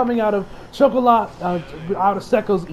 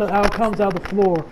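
ordinary talk

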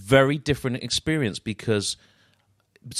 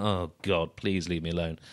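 low, softer mock groan of annoyance; weary guest; 5 semitones lower, 6 dB quieter than usual. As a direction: sigh it out, wanting them gone. Guest reacting to the magician.

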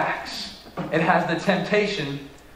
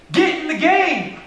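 much higher and louder preacher's cry; rallying shout; 13 semitones higher, 5 dB louder than usual. Real, not acted. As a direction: call the whole church to get in the game.

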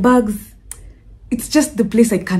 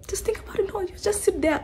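whisper; 10 semitones higher, 10 dB quieter, faster than usual. Real, not acted. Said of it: "whispered aside to viewers".

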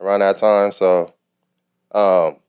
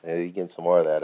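normal talking voice

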